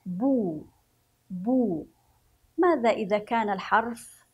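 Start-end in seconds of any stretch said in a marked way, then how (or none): 0.0-1.9 بو said with lips pushed round; rounded bu sounds. Shown as a demonstration. Teacher showing children the damma with rounded lips. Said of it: "سنضم شفتينا بهذا الشكل".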